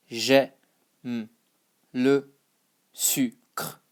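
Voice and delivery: "J'aime le sucre" in separate pieces with pauses; broken-up phrase. Shown as a demonstration. Teacher modelling the phrase for learners, piece by piece. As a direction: sound out "J'aime le sucre" bit by bit.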